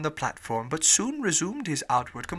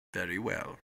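low, quiet, cold reply; 9 semitones lower, 9 dB quieter than usual. A narrator's reading. Fogg to the captain who refuses him, quietly cold. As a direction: answer coldly, voice dropped. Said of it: "said Mister Fogg coldly".

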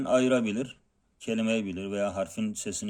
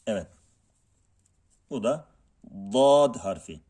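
ordinary talk

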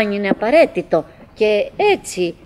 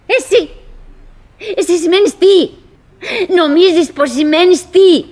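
loud, high, contemptuous jabs; scornful outburst; 6 semitones higher, 7 dB louder than usual. Acted. [name] acting out the wife's loud scorn. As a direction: spit the question back at him. Retold wife mocks him.

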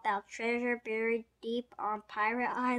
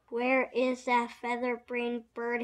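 ordinary delivery

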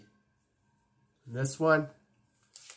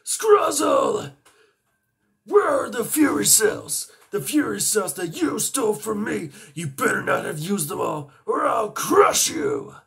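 high, loud, villainous monster yelling; monster shout; 8 semitones higher, 6 dB louder than usual. Acted. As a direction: roar threats like a cartoon villain. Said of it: "his name is Vargoyle".

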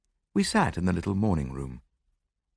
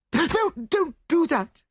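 high-pitched, drawn-out panicked plea; terrified gasp; 4 dB louder, slower than usual. A narrator's reading. Frightened widow begs detective not to lock the door, gasping in terror. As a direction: plead in breathless fright, pitched way up. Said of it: "she gasped".

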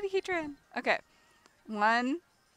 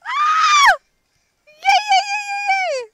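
shrieking jackpot winner; 14 dB louder, slower than usual. Real, not acted. Shiny Heatran found.

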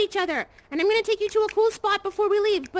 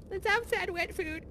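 mock sad whisper; 10 dB quieter than usual. Mock sad wet food toast.

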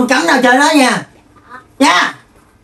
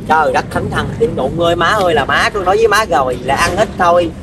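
low, softer weary complaint; exasperated grumble; 6 semitones lower, 4 dB quieter than usual. Acted. Child scolding mother for eating too much, grumbling wearily. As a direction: grumble under the strain, voice dropped.

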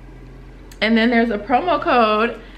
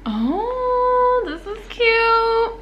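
cute squeal; 12 semitones higher, much slower than usual. Real, not acted. Opening next box.

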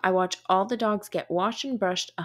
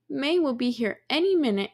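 ordinary delivery, nothing marked

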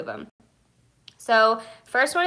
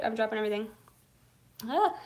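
usual speaking voice

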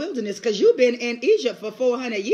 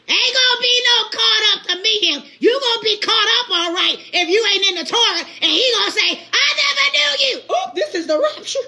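high, loud preaching build; preaching shout; 9 semitones higher, 5 dB louder than usual. Real, not acted. Preacher warning the congregation about the rapture, loud. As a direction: hammer it home from the pulpit.

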